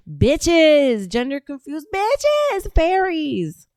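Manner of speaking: high, drawn-out taunt; mocking name-calling. Real, not acted. Host rejecting being these little weaklings, mocking taunt.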